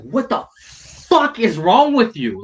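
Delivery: replaying the rage at the guy, dragging each word; retold angry outburst. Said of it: "I was so pissed".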